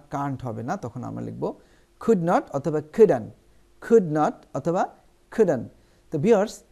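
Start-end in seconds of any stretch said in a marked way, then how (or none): 2.0-5.6 slow, careful could not and couldn't; slow teaching demo. Shown as a demonstration. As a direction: spell the forms out slowly. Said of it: "teaching the contraction".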